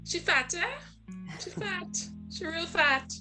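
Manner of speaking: high-pitched imitation of judging relatives; Trinidadian relatives' remarks. Relatives in Trinidad commenting on her body, high-pitched mimicry.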